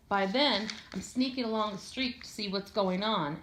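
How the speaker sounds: ordinary delivery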